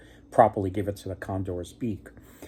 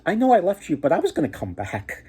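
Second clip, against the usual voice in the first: star maiden; 8 semitones higher, 3 dB louder than usual. Retold or imitated. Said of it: "and she said".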